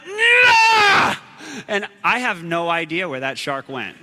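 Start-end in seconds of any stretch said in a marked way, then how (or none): 0.1-1.1 high, loud cry of pain; pained yell. Retold or imitated. Boy bitten by the shark, yelling in pain. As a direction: yelp as teeth sink in. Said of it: "And I went".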